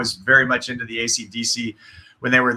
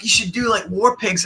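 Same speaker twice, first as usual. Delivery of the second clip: [name] suggestion; 8 semitones higher than usual. Retold or imitated.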